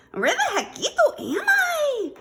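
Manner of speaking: very high, loud kitten voice; wondering aloud. A narrator's reading. Scapito lost at world's end, wondering loudly. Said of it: "wondered Scapito aloud".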